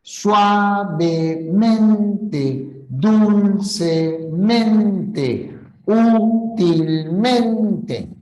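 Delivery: each adverb stretched out, very slow; very slow reading. Shown as a demonstration. Teacher reading the adverbs very slowly to the class. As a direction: draw every syllable out. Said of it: "acá dice".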